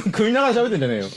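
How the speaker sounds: 笑いながら突っ込み. Real, not acted.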